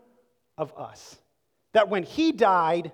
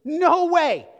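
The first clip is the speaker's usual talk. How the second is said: high, loud "No way" outburst; emphatic; 7 semitones higher, 6 dB louder than usual. Shown as a demonstration. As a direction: fling "No way" out like a refusal. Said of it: "emphatic statement".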